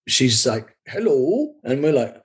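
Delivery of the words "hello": higher-pitched imitation of a German accent; old lady's German-accented hello. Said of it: "I'll stop doing the German accent".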